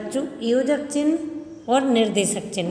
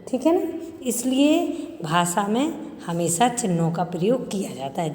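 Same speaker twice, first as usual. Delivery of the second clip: ordinary talk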